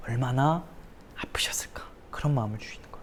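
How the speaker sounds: almost silent voiceless whisper; tender whisper. Acted. Preacher to congregation, tender near-silent whisper. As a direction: let it fall to a bare whisper.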